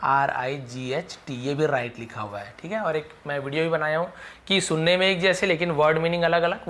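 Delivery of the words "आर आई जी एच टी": letters spelled one by one, slow; spelled out slowly. Shown as a demonstration. Teacher spelling RIGHT for students, slow and clear. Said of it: "सही वाला spelling जो right होता है उसका".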